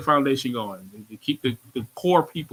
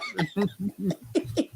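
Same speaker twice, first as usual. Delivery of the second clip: laugh; 5 semitones higher, 4 dB quieter than usual. Real, not acted. Host laughs at own story.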